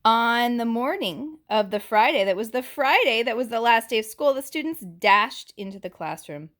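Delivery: put-on lively read-aloud for little kids; picture-book narration; story reading. A narrator's reading. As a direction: read the book brightly to the class. Reads picture book to class.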